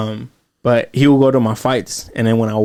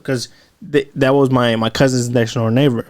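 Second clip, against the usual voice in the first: ordinary talk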